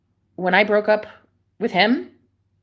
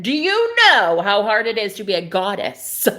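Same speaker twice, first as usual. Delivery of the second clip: loud, theatrical goddess voice; diva retort; 3 semitones higher, 6 dB louder than usual. Pretend. Mocking her boyfriend's line as a goddess, theatrical. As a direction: flip his line back, grandly.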